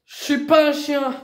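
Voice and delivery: high, outraged cry; indignant shout. Real, not acted. Young woman refusing to be ordered about, indignant shout. Real quarrel, outraged protest.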